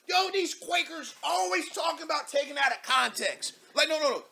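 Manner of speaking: loud, high rant about "context"; exasperated rant. Real, not acted. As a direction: shout your frustration at the post. Ranting at the post.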